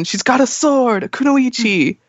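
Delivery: lightly acted high-pitched worry; worried onlooker. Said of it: "everyone's so worried about this".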